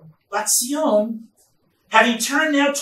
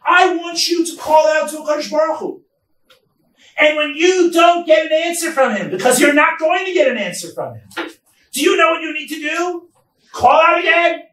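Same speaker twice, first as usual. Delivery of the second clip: higher and louder, the prophet exhorting the city; urging call; 6 semitones higher, 5 dB louder than usual. Acted. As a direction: shout it like Yirmiyahu rousing the people. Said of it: "Yirmiyahu saying".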